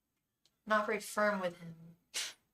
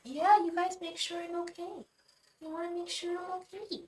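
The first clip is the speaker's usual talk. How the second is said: pet talk; 8 semitones higher than usual. Real, not acted. Cooing thanks to the cats.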